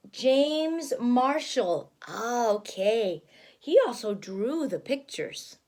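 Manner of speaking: ordinary delivery, nothing marked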